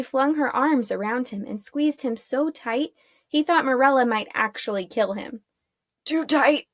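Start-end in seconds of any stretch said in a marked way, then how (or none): 6.0-6.7 strained, louder grunt of protest; grunted, as if being squashed. A narrator's reading. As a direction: squeeze the words out higher and louder. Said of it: "he grunted".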